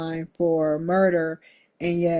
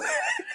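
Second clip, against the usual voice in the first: short high-pitched giggle; a quick squeaky laugh; 5 dB quieter than usual. Real, not acted. Laughing at own joke.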